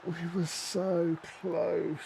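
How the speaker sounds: high, drawn-out plaintive groan; gutted lament. Real, not acted. Player who just conceded a late goal, gutted lament. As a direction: stretch every word in agony.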